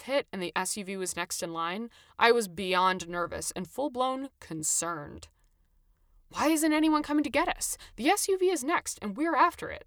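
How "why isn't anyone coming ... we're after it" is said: higher, worried and pressing; rising worry about missing the turn; fretting. A narrator's reading. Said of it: "I fretted".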